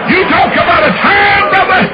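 high, loud joyful shout; joyful preacher's cry; preaching shout. Real, not acted. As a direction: belt it out to the congregation.

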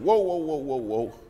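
high, startled string of protests; alarmed interruption. Retold or imitated. Preacher stopping lawyer's shocking prayer, startled. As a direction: cut in to halt him, pitch jumping up.

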